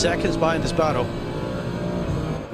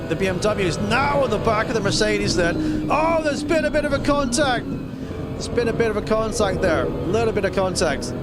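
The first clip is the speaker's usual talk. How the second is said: sudden excited race call; 8 semitones higher than usual. Real, not acted. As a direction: pitch jumps up on the contact. Contact on track, excited call.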